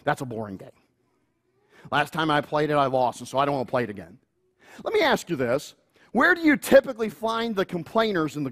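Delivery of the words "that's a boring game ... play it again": low, mumbled sulky whine; sulky teenager. Retold or imitated. Teen complaining about the game, low sulky mumble. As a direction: grumble it like a bored teen.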